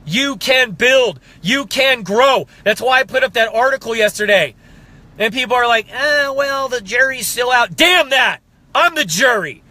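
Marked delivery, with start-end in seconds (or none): 5.9-7.8 sneering skeptic quote; mocking the doubters. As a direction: brush it off like a smug doubter.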